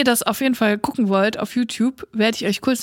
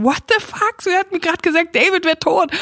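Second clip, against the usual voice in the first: much higher, baffled cry; stunned outrage; 7 semitones higher than usual. Retold or imitated. Tiffany finds David alive in bed, a baffled high cry. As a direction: squeal it in disbelief.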